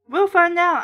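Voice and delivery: high, loud and happy; happy exclaim. A narrator's reading. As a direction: toss it out with glee. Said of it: "I exclaimed happily".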